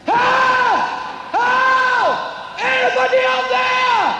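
hammed-up cry of a man dangling over the sea; desperate shout for help. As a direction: scream for help from the cliff edge. Man hanging from cliff shouting.

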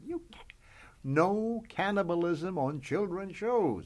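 high, then slow prim censor; mock network censor. Quoting the network's ban on cannibalism, mock prim. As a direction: spell out the ban like a fussy censor. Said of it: "And they said".

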